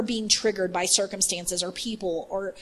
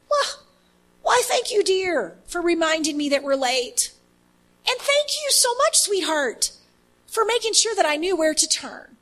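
mock sweet reply; 6 semitones higher than usual. Ideal rational reply, mock sweet.

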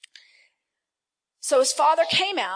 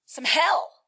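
punchy; 4 semitones higher, 6 dB louder than usual. Real, not acted.

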